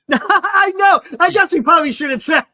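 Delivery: high, loud laughing talk. Real, not acted. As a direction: speak through laughter. Laughing at own story.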